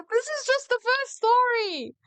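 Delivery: very high, exasperated cry; mad exasperation. Real, not acted. Reacting to only the first story, mad and exasperated. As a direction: exclaim it high, at wit's end. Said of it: "I'm mad".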